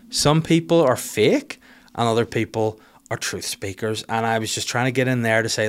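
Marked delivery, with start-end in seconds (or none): none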